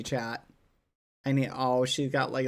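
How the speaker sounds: normal talking voice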